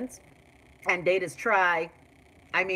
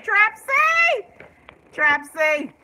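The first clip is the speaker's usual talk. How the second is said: high, loud call across the room; 12 semitones higher, 8 dB louder, much slower than usual. Real, not acted. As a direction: shout a name to someone away. Calling out to Trapsy.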